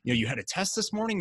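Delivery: high-pitched upbeat reassurance; eager encouragement. Retold or imitated. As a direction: lift him up like a beaming coach. Mentor reassuring after poor run.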